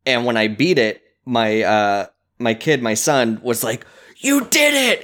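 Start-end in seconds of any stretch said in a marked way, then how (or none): 4.2-5.0 high-pitched, excited cheer; much higher, gleeful exclamation; excited kid. Retold or imitated. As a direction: imitate a thrilled child cheering you on. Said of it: "he was all excited with me".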